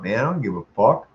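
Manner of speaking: ordinary talk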